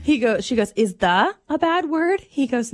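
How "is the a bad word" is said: put-on raised, slow, questioning child voice; a curious kid checking each word; innocent little girl. Mom voicing her daughter asking if "what" is bad. Six-year-old's question.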